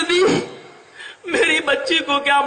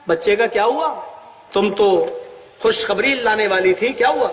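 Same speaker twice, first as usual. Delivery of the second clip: lower, pressing husband's question; stern father asking; 6 semitones lower than usual. Acted. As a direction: ask like a suspicious father. Preacher voices father questioning wife.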